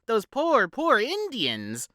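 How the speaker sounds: high, slow, overdone pity; society lady feigning grief for Indians; mock mournful matron. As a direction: drag out the fake sorrow. Mother voiced mournfully.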